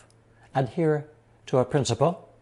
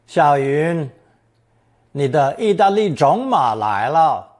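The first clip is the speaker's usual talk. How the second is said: loud, raised-pitch call to someone; 4 semitones higher, 8 dB louder than usual. Acted. Announcing a girl's boyfriend to her, calling out loudly. Calls out to a girl in example.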